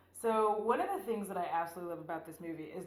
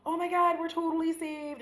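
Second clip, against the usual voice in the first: much higher and louder, thrilled; mock overjoyed cry; 9 semitones higher, 6 dB louder than usual. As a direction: squeal with relief. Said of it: "they're like".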